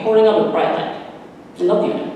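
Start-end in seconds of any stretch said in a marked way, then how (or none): none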